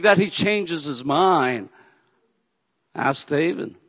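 ordinary talk, nothing marked

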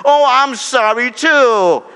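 slow, high, loud fake pity; mock sympathy. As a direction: drag out the insincere sorry. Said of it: "don't say to the person".

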